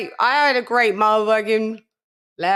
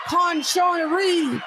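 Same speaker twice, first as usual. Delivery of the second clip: hype shout-out; 7 semitones higher than usual. Real, not acted. Hyped roll call shout.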